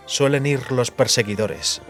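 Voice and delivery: ordinary delivery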